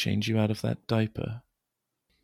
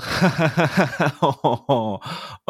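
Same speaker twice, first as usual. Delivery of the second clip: giggly cackle; 6 semitones higher, 5 dB louder than usual. Acted. Laugh during tickle.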